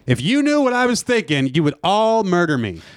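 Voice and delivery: high, theatrical announcement; dramatic confession. Pretend. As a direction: proclaim it to the whole room. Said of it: "I clap my hands and I go".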